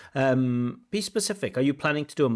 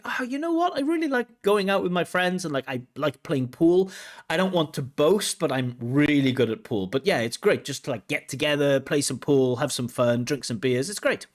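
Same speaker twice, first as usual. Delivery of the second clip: enthusiastic demo; 3 semitones higher than usual. Shown as a demonstration. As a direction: show how talking about fun should sound, bright and lively. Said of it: "listen to the candidate's pronunciation and intonation".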